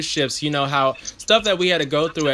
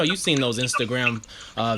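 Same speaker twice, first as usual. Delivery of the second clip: ordinary talk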